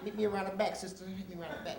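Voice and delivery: hushed aside. Retold or imitated. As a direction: lure her quietly aside. Pastor whispering aside.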